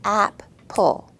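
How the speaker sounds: loud two-beat app-le; syllables pounded out. Shown as a demonstration. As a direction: pound each beat of Apple. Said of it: "Let's fist pound each syllable".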